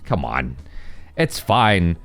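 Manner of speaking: ordinary talk, nothing marked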